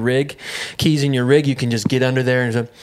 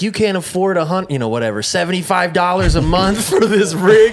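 mock berating; 5 semitones higher, 3 dB louder than usual. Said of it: "verbally berating me".

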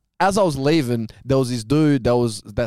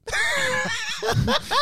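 high shrieking laugh; 5 dB quieter than usual. Real, not acted. Storyteller shrieks with laughter at his own blunder.

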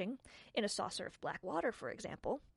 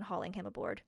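ordinary delivery

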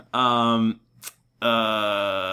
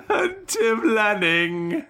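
slow, higher, laughing host voice; terrible voice; 9 semitones higher, much slower than usual. Acted. Host introducing Tim, laughing through a terrible voice. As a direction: drag the name out while cracking up. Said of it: "This is the Terrible Voices episode".

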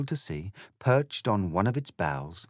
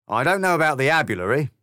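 high, louder, cocky grinning cat; smug cat; 6 semitones higher, 7 dB louder, much faster than usual. Acted. The grinning cat answering Alice with a swagger. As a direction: toss it off like a swaggering pub cat.

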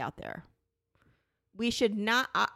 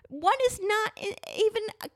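heated insistence; 12 semitones higher than usual. Real, not acted. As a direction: push the point up high, outraged. Arguing against judgment.

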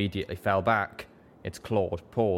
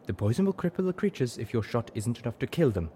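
higher, firm zebra instruction; instructing; 5 semitones higher than usual. A narrator's reading. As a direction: calmly tell her what to do. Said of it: "she instructed".

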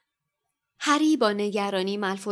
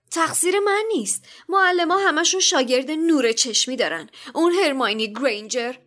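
higher, cheeky schoolboy talking back; quick retort; 7 semitones higher, 5 dB louder than usual. A narrator's reading. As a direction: snap back like a sulky teen. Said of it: "با حاضر جوابی گفت".

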